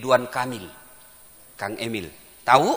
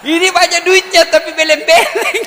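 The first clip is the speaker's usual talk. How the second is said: high, loud comic verdict; shouted punchline; 15 dB louder than usual. Acted. Preacher summing up rich but foolish Haji, shouted punchline. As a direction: deliver the punchline at full preaching force.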